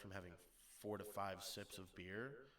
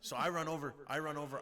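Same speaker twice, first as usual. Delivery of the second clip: excited telling; 6 semitones higher, 9 dB louder than usual. Real, not acted. Laughing, excited climax.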